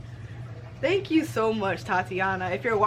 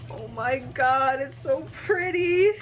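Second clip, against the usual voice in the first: rising, overjoyed gush; thrilled gushing; 3 semitones higher than usual. Real, not acted. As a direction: voice climbing high with delight. Gushing over the books.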